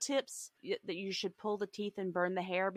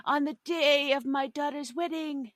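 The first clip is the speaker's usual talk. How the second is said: slow, higher mafia don impression; Godfather mob boss; 6 semitones higher, 3 dB louder, slower than usual. Third little pig talking like a mafia don. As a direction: play it like a menacing crime boss granting an audience. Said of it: "the third little pig is like".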